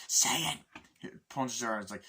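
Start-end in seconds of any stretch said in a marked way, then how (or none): 0.1-0.6 higher and louder, a sharp bark; louder menacing demand. Retold or imitated. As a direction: snap the order at her. Character demanding loudly.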